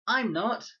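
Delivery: put-on high, loud, quick child's retort; prompt little boy. A narrator's reading. Max answering Leela at once, loud high boy. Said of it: "said Max promptly".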